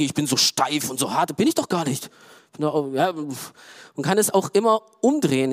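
ordinary delivery

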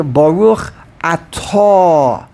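slow, "Atah" greatly stretched on its end, a-TAAH; emphasis on the Taf. Shown as a demonstration. Rabbi voicing the question, end-stressed "Atah". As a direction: hold the last syllable of "Atah". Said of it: "It's always on the Taf".

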